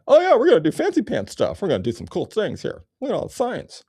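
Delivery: high, loud, airy goofy mock voice; mocking this guy. Mocking the man in the picture, high goofy voice. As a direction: ham up a smug tech bro. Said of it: "Look at this guy".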